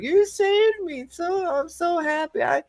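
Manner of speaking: tiny, very high slow squeak; squeaky, hammed-up pleading; mock grateful damsel. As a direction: falsetto damsel, gushing then begging. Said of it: "she's like".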